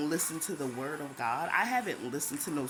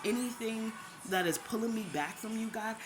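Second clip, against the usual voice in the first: prayer; 3 semitones higher than usual. Retold or imitated. Retold shower prayer.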